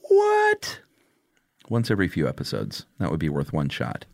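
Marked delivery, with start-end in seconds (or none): 0.1-0.8 high, squeaky shocked "What?"; surprised. Retold or imitated. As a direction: yelp it in disbelief. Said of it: "And Chuck goes".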